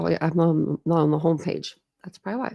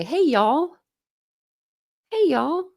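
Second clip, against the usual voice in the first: Southern greeting; 9 semitones higher, slower than usual. Shown as a demonstration. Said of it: "when they say".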